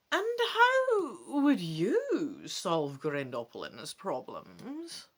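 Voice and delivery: trage, hoge oude vrouw met krakende toon; oude waarzegster. Acted. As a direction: vraag het langzaam en bedachtzaam. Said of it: "she asks".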